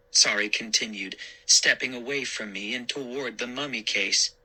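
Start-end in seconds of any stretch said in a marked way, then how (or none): none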